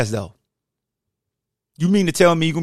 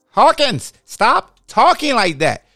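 scolding coach; 9 semitones higher, 4 dB louder, slower than usual. Retold or imitated. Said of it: "Coach would be like".

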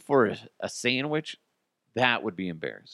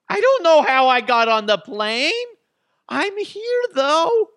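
much higher, louder airhead voice; ditzy caller; 9 dB louder than usual. Retold or imitated. Host playing a worker stuck in Guam, high and ditzy. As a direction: squeal it like a clueless employee.

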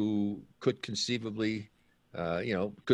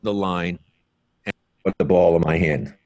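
loud bursts of uneven volume; 3 semitones lower, 12 dB louder than usual. Real, not acted. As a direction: audio jumping loud and back. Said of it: "your audio keeps switching like that".

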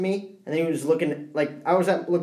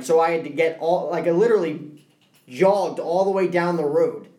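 ordinary talk

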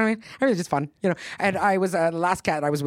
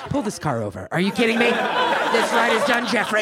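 indignant order building higher and louder; mock outrage; 5 semitones higher, 3 dB louder than usual.

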